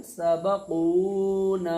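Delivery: सबकूना slow and loud, the long vowels held; stretched madd recitation. Shown as a demonstration. Teacher models the correct reading of सबकूना, slow and loud. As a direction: draw out कू and ना in सबकूना. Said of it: "कैसे पढ़ेंगे?".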